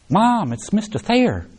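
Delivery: high, urgent call to Mom; imitated girl's shocked discovery; startled young girl. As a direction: exclaim as a girl recognizing a face. Girl tells mother of photo found.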